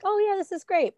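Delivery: high, breezy fake excitement; mock enthusiasm. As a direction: toss it off like a casual fan. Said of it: "when you're like".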